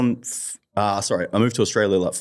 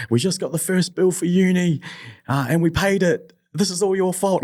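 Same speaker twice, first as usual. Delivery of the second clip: high, mock upset mum voice; imitating crying mum; 10 semitones higher than usual. His mum on the phone about the uni bill, high mock-upset. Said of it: "my mum rang me crying".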